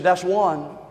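low, soft deadpan warning; cold quiet warning. Retold or imitated. Wife's punchline warning.

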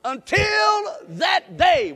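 very high, loud, slow shouted phrase; even bigger shout. Shown as a demonstration. Preacher leading the congregation in the phrase again, big shout. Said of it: "One more time".

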